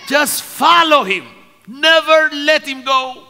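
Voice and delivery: high, loud preacher's exhortation; impassioned preaching. Real, not acted. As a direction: cry it out to the congregation.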